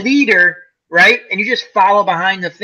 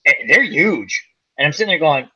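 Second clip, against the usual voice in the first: usual speaking voice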